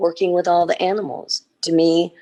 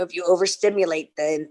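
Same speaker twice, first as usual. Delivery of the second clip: usual speaking voice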